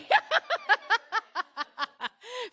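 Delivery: high, rolling burst of laughter; big laugh. Real, not acted. Presenter cracking up at the audience's answer, big laugh. Amused by audience reply mid-talk.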